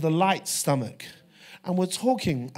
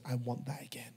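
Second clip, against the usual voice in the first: ordinary delivery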